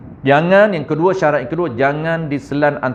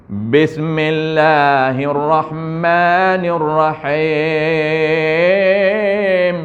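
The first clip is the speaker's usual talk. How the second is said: Bismillahirrahmanirrahim very slow, letters dragged Hindustani style; Hindustani-influenced recitation; much slower than usual. Shown as a demonstration. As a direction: chant Bismillahirrahmanirrahim in a Hindustani accent. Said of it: "terpengaruh dengan Hindustan".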